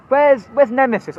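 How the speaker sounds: ordinary talk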